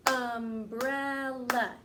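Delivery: umbrella stretched slowly, each syllable clear. Shown as a demonstration. Teacher modelling for the class, slow and syllabic. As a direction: draw out umbrella in beats. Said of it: "Let's say the word".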